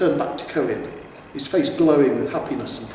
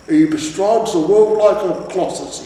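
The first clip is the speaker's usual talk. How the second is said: raised, grand quoting voice; reverent troll; 6 semitones higher, 8 dB louder than usual. A narrator's reading. Troll quoting his father about the hero, awed. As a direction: quote it with awe.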